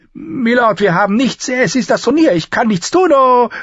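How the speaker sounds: high, loud frantic outburst; frantic, beside herself. A narrator's reading. Innkeeper beside herself before the lord, high and frantic. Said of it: "Marshal Harrel war außer sich".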